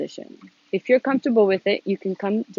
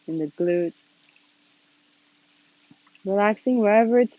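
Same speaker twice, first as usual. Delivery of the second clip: calm, unhurried instruction with long pauses; gently leading a seated meditation, leaving space between phrases; guided meditation. Real, not acted. Leads a meditation.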